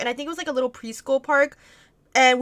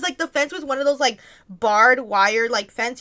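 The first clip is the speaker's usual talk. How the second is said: ordinary talk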